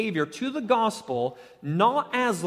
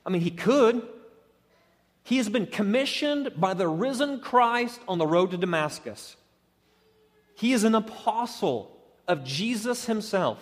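ordinary talk, nothing marked

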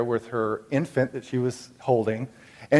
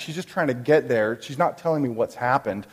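ordinary delivery

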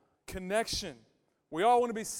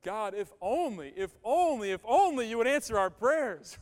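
rising, louder pleading "if only"; a pastor voicing a desperate prayer; 3 semitones higher, 7 dB louder than usual. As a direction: beg God, building with each "if only". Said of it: "what Mary said".

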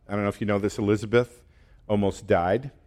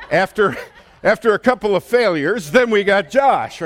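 laughing through it, higher and louder; 9 semitones higher, 6 dB louder than usual. Real, not acted. Joking to the class about Josh, laughing. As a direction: chuckle out the punchline about Josh.

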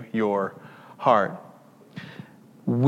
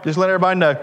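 higher and louder, calling it out to the room; joking about wanting to shout that he gives by debit card; mock announcement; 5 semitones higher, 5 dB louder, faster than usual. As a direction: announce it to everyone, loud and high. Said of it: "I wanted to say".

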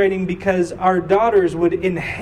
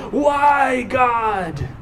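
raised, drawn-out plea; anguished cry; 5 semitones higher than usual. Acted. Preacher voicing people who want answers, high pleading cry.

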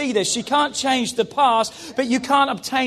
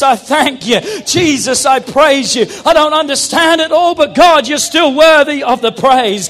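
loud, building declarations of praise; full-force preaching; 4 semitones higher, 9 dB louder than usual. Real, not acted. Preacher vowing praise no matter what, preaching at full force. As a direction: cry out praise, building higher and louder.

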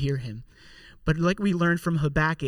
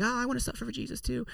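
much higher, softer, fast and breathless; mock pious voice; 7 semitones higher, 10 dB quieter, faster than usual. Preacher mocking people who say they want to suffer, in a high, soft, quick mock voice. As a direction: send up an overeager believer, high and quick. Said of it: "some people are like".

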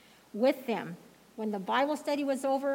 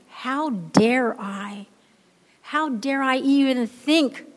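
slow, louder rhetorical question; emphatic build; 3 semitones higher, 5 dB louder, slower than usual. Acted. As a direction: press each word of the challenge. Preacher rebuking himself emphatically.

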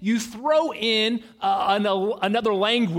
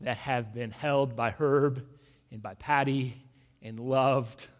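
soft, low, unhurried prayer voice; hushed prayer; 10 semitones lower, 9 dB quieter than usual. Acted. Pastor praying over a grieving family, hushed and low. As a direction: pray gently and reverently over the congregation.

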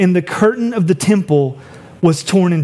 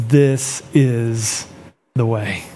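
tender Jesus; 6 semitones lower, much slower than usual. Retold or imitated. Jesus answering the criminal on the cross, low and tender.

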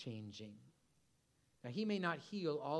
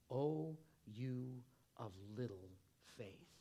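much softer and slower, sinking to almost nothing; gentle rebuke; 4 semitones lower, 7 dB quieter, slower than usual. A narrator's reading. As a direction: let the rebuke fall soft and slow. Jesus gently rebukes the disciples.

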